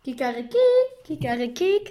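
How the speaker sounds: crowing cock-a-doodle; rooster crow. Acted. Rooster crows in story.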